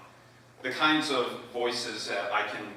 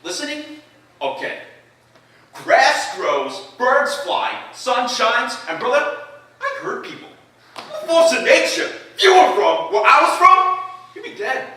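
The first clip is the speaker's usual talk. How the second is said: put-on much higher, loud, cocky Boston accent; Scout; 14 semitones higher, 11 dB louder than usual. A cocky Boston kid boasting he hurts people, shouting. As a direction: brag in a fast, brash Boston accent. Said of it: "And then the scout".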